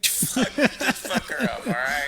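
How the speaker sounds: high slow mocking cop. Friend mocking police advice, high and slow. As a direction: stretch it out like a goofy officer.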